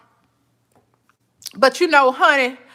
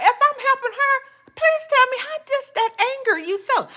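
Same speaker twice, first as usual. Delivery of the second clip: mock sweet challenge; 12 semitones higher than usual. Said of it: "I'm like".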